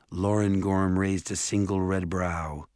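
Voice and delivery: ordinary delivery, nothing marked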